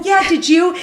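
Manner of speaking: bursting to share the news; excited reply. Retold or imitated. Telling friend Kim she got in, eager and excited. Answering Kim about the seeds.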